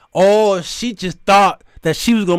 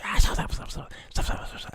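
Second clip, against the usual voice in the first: rapid hushed mock whisper; 10 dB quieter than usual. Woman in the lawyer's ear, mock whispering. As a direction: whisper urgently into an ear, imitating her. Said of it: "She's whispering".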